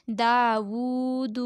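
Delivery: Dawood with "daa" and "woo" drawn out, "du" kept short; long vowels, short ending. Shown as a demonstration. Teacher demonstrating the rule on Dawood, only first syllables lengthened. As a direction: hold Dawood slowly but never stretch its last letter. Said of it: "आखिरी दाल को लंबा नहीं करेंगे".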